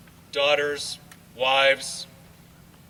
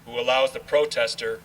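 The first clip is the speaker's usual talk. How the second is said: solemn recitation. Acted. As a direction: proclaim each line like a memorial tribute. Recites patriotic poem at ceremony.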